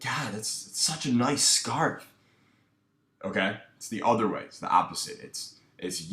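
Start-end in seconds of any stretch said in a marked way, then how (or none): 0.0-2.1 higher, slow and eager, pitch climbing up; seeking rapport tone. Shown as a demonstration. Coach demos the wrong, approval-seeking compliment, rising eagerly. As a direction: gush up the scale like you want her approval. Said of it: "my voice isn't going up".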